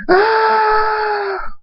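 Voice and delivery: very high, drawn-out scream; long anguished scream. Acted. Thunderstorm vowing to avenge his mother, long high scream. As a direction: scream out grief and rage. Enraged grief, storm roars.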